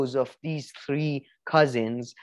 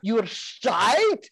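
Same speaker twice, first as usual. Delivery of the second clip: mock Scottish accent; 4 semitones higher than usual. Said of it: "but he's a Scottish accent".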